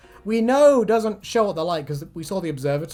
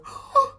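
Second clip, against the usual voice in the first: sharp, high little gasp; wide-eyed gasp. Acted. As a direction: gasp like big sad eyes. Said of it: "her giant eyes go".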